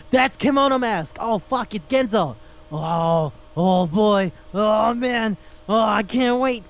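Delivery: mock-thrilled fanboy reaction; acted giddy excitement. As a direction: act out breathless anticipation of a rival. Acting out wrestler spotting his rival.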